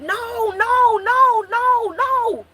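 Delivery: begging in terror as the gun goes off; panicked high pleading no; desperate pleading. Retold or imitated. Seeing her brother being shot.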